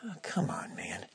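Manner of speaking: exasperated whisper. Retold or imitated. Exasperated aside to himself.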